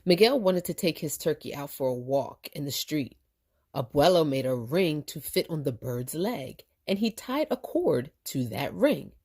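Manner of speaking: usual speaking voice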